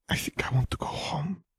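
high, breathy whisper near tears; tearful whisper. A narrator's reading. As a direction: whisper it, close to crying. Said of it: "the boy whispered".

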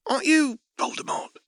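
put-on a shop girl suddenly thrilled to spot him; squealing surprise at a famous customer; perked up. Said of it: "The girl behind the counter perked up".

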